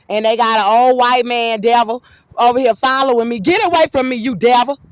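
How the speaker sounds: loud, high-pitched shouting; real angry shouting. Real, not acted. Speaker shouting at the man following them, angry.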